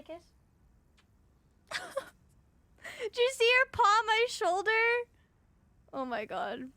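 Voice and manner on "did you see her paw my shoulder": excited. Real, not acted. Thrilled at cat's gesture.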